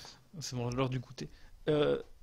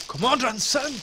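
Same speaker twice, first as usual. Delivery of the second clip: higher quoted voice; imitated YouTube bit; 9 semitones higher, 5 dB louder, slower than usual. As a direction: mimic the comic line. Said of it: "et ils font".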